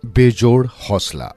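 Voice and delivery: plain reading voice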